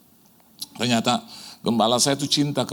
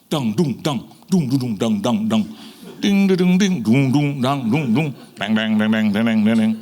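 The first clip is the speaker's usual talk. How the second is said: louder chanted dang dung deng falling dominoes; domino sound effect; 3 semitones lower, 3 dB louder than usual. Retold or imitated. Preacher sounding out ministries falling like dominoes. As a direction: tap out the dominoes toppling one by one.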